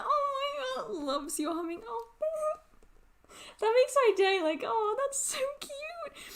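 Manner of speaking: high, teary squeals of delight; touched and overjoyed. Real, not acted. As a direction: melt at the news, close to tears. Dog loves your humming, overjoyed.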